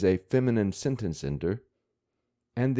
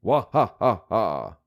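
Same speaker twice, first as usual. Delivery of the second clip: hammed-up "wa ha ha" laugh, a bit louder; mock hearty laugh; 4 dB louder than usual. Shown as a demonstration. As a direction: imitate a cartoon kid's big fake laugh. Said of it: "This is a sound effect of a loud laughing".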